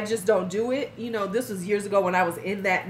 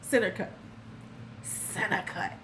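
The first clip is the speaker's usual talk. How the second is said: creepy imitation; 2 semitones lower, 8 dB quieter than usual. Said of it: "this is what he says".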